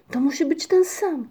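high, loud cry; excited cry. A narrator's reading. Panna Migotka cries out recognizing the hat, high and loud. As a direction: shout it out, certain. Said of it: "krzyknęła panna Migotka".

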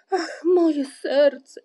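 loud, high, drawn-out groan; wailing, long-stretched lament; moaning. A narrator's reading. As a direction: moan slowly in woe. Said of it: "jęczał".

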